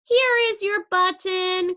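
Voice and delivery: high, bright call of discovery; Frog crying out. A narrator's reading. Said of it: "cried Frog".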